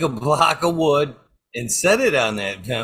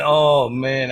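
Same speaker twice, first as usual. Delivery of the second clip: ordinary talk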